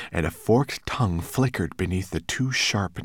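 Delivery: plain reading voice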